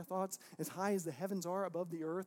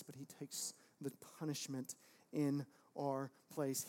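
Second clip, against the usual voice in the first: normal talking voice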